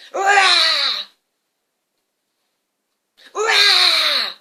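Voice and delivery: very loud, high roar; dragon roar. Acted. Dragon roars from the box.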